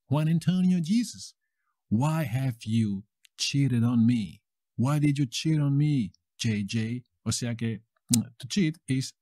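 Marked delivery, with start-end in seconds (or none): none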